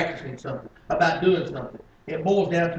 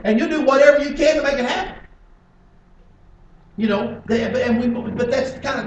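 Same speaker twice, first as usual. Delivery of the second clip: loud, high-pitched pulpit delivery; raised preacher's voice, punching the key words; full-force preaching; 8 semitones higher, 10 dB louder than usual. Acted. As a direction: hammer the point home to the congregation.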